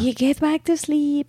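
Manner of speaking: low-energy soothing. Retold or imitated. Mom settles the baby at night, low-energy soothing. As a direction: gently lull a child, unhurried. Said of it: "with a very low energy".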